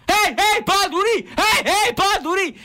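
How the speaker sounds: high-pitched chanted yell; imitated stadium yelling. Storyteller imitating the announcer yelling in his ear, high shouted chant. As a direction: blast the team chant into someone's ear. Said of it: "Îți urla ăla în ureche".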